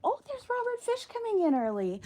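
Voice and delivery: sudden delighted surprise; surprised high exclamation. Real, not acted. Spots an animal arriving.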